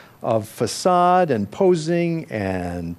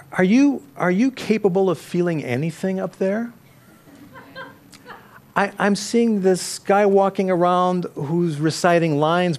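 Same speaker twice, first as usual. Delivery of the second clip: higher, pushing, incredulous director; raised, needling director voice; director's exasperated challenge; 6 semitones higher than usual. Retold or imitated. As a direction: quote the frustrated director demanding feeling. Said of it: "he'd stop the rehearsal and he'd say".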